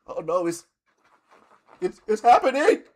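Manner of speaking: mock death scene, high and drawn out; hammed-up dying. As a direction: play up dying on the train. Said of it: "You gotta really lean into it".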